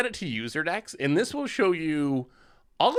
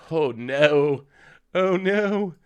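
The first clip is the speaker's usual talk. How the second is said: loud dismayed groan; 5 dB louder, much slower than usual. Real, not acted. Dismay at bad draw.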